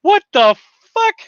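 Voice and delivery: high, loud stunned outburst; shocked. Retold or imitated. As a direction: blurt it in disbelief. Shocked reaction quoted.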